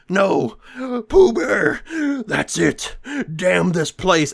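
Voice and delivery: high, loud hammed-up wail of grief; anguished cry. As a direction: cry out for the lost dog. Farmer finds dog taken.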